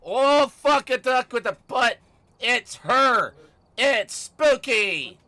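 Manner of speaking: loud, high cry of alarm; startled yell. Real, not acted. Streamer spotting Spooky in the game, startled yell. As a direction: yell it out, high and drawn out at the end.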